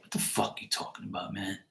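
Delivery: soft whispered curse, nearly voiceless; whispered disbelief. Retold or imitated. Friend whispering back at the claim his dad is here. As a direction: breathe it out, stunned and doubtful. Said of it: "And he was like".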